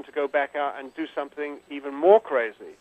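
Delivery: usual speaking voice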